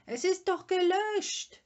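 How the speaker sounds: high, loud, urgent shout; shouting after someone. A narrator's reading. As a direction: yell it after a running father. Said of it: "schrie Mumintroll ihm nach". (laut hinterherschreiend)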